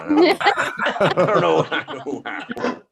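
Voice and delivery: high drawn-out whine fading to a breathy giggle; mock helpless young man; imitating a lost twenty-year-old; whiny youth.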